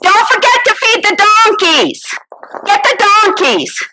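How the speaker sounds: calling out. Acted. Calling to someone offstage.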